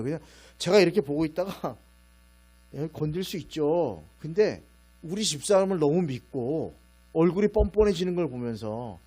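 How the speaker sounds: usual speaking voice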